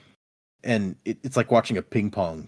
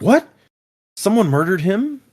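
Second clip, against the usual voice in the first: mock-astonished, higher-pitched question; shocked disbelief; 10 semitones higher, 4 dB louder than usual. Wit reacting to news of a death, shocked disbelief. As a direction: act out hearing startling news.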